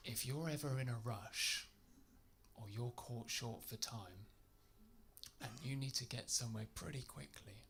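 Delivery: overly polite lecture to the rude customer; mock polite advice. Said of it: "I went".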